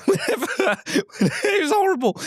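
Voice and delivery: high, squeaky laughing through the words; real giggling, voice shooting up in pitch; helpless laughter. Real, not acted. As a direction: barely get it out for laughing. Laughing at his own horrible memory.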